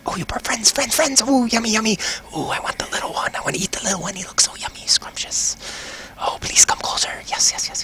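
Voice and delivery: high, eager creepy voice that drifts into a whisper; hungry voice in his head. Acted. Voice in the rogue's head begging him for food. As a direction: coax him inward like a starving thing.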